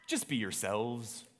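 put-on understated answer in an amateur Scottish accent; William Wallace. Leader responds to his buddy before battle, Scottish accent. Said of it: "switching over to my Scottish accent".

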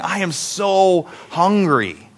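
slow, drawn-out hungry complaint; longing hunger. Acted. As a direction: stretch it out, aching for food. Acting out all-day hunger.

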